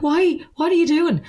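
high-pitched retold exasperation; mock-incredulous high questioning; exasperated protest. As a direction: re-enact a baffled protest, voice shooting up. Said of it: "And I was like".

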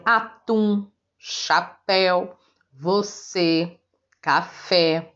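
"atum, chapéu, você, café" each slow, leaning on the last syllable; teacher's slow list of oxytone examples; final-syllable stress. Shown as a demonstration. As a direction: spell out each word by syllable, last one strong. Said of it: "é a sílaba forte".

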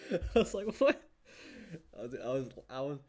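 high, loud laughing disbelief; reliving a laughing fit, pitch shooting up; retold hard laughing. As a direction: laugh first, then squeal the words through it. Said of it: "I was laughing so hard".

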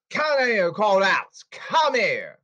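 shouted summons. Real, not acted. Ranter calling out the Mets people, angry shouted summons. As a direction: shout them over here forcefully. Calls the called-out people over.

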